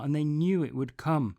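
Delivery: usual speaking voice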